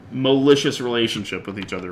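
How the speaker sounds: ordinary talk, nothing marked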